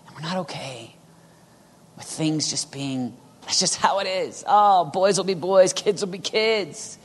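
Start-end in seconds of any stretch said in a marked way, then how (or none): 3.4-7.0 lightly mocking, higher-pitched shrug of a voice; mildly put-on, drawn-out dismissal; mock complacency. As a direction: imitate someone lazily excusing it all, slowly. Mimics people who accept things.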